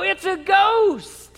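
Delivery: high, loud, slow shriek of terror; terrified scream. Retold or imitated. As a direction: scream at the thing on the water. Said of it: "they go".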